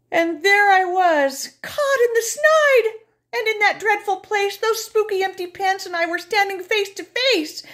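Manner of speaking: high, loud, sobbing fear; terrified crying. Acted. Narrator trapped with pants, terrified crying. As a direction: wail in dread, trapped.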